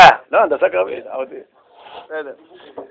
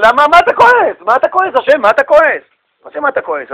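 higher, pushy, needling questions; cheeky Moses; 6 semitones higher than usual. Acted. Moses challenges God, cheeky and pushy. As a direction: poke at him: why are you angry.

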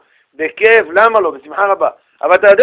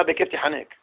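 low, soft, sarcastic "בכיף תיחנק" under the breath; muttered sarcasm; 5 semitones lower, 13 dB quieter than usual. Retold or imitated. Student voicing what the grudging sharer really means, soft and sarcastic. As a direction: mutter it as a hidden curse. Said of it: "הוא אומר לך, הכוונה".

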